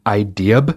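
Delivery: "idea" ending in an r, like idear. Shown as a demonstration. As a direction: tack an r onto "idea". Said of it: "because idea is not a word".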